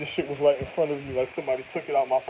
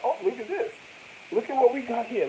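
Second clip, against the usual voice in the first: higher mock-excited fisherman; 8 semitones higher than usual. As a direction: play the thrilled angler.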